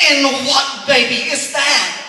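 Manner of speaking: flustered lady. Retold or imitated. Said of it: "She was like".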